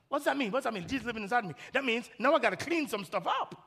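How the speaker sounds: usual speaking voice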